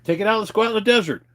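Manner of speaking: high, fast and loud egging on; eager push. Retold or imitated. Daring his friend to take the Jeep off-road, fast and high. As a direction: hurry him into going off-road. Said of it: "And I'm like".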